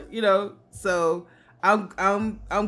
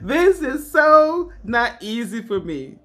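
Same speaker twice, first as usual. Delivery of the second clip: laughing; 4 semitones higher, 4 dB louder, slower than usual. Real, not acted. Laughing at own clumsiness.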